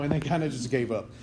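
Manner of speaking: usual speaking voice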